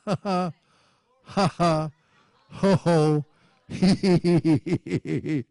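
measured ha-has running into a tittering hee-hee; deliberate laughing. Shown as a demonstration. Preacher laughing at destruction and famine, deliberate laughing. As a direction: laugh on purpose, syllable by syllable. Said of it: "God laughs at the devil".

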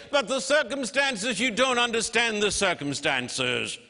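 high, pleading mock objection; imitated objector. Preacher mimicking a listener who excuses the torture. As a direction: whine the excuse back at them, mock-pleading. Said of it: "You say".

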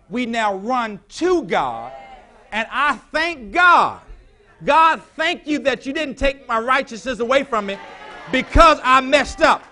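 high, loud, slow preacher's cry of thanks; shouted preaching prayer. Acted. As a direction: throw the prayer to the rafters. Shouted prayer at full force.

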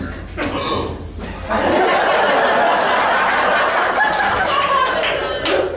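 long, high, breathy mock gasp of shock; theatrical shocked inhale; exaggerated gasp. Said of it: "He was like".

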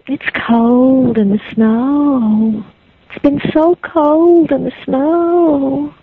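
put-on slow, drawn-out ghostly child.

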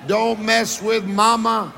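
slow, with weight on "mess"; preacher drives the title home to the congregation; emphatic slogan. Shown as a demonstration. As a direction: land "Don't mess with mama" like a punchline. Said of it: "tell two or three people".

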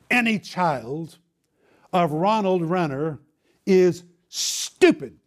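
slow, punched put-down with a hissed start on each STUPID; spitting contempt. Retold or imitated. Algebra teacher shaming a pupil before the class, spitting contempt. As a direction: imitate the teacher spitting out STUPID, hard and drawn out. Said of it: "exactly the way she said it, spitting".

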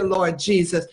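ordinary talk

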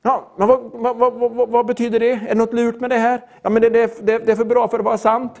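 stammering, hurried mock suspicion; suspicious doubter. Sceptic questioning a free gift, suspicious stammering. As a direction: stumble over the words like a wary sceptic. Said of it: "Tänk inte, "Vad är det här för någonting?".

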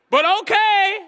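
high, loud reluctant okay; loud mock okay. Preacher voicing a reluctant disciple, loud. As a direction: give in with a yelp.

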